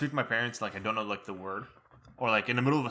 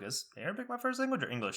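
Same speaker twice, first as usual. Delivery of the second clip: soft, high-pitched question; quiet, raised-voice query to a parent; retold question to mom; 9 semitones higher, 6 dB quieter than usual. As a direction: ask it high and light, curious. Retells asking mom a question.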